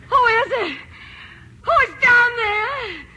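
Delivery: high, very slow, frightened call into the dark; woman alone hearing an intruder; terrified calling out. Acted. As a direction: call down the stairs in terror. Hears someone listening downstairs.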